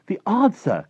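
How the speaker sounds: high, loud triumphant announcement; bursting with the discovery; excited cry. A narrator's reading. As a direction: exclaim it as a thrilled girl. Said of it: "cried Sophie".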